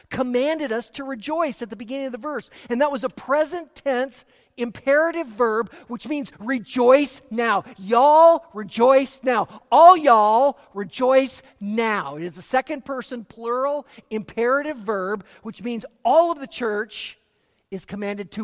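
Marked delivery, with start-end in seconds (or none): 6.4-12.2 loud preacher's exhortation, punching each phrase; emphatic command. Shown as a demonstration. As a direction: call the whole congregation to act, forcefully. Preacher drives home the command.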